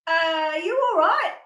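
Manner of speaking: loud concerned call across the office. Retold or imitated. Calling out to crouching coworkers.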